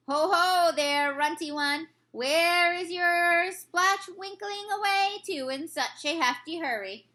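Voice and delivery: mocking brute giant calling out; bullying giant. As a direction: bellow the taunt like a brute. Bully giant taunts loudly.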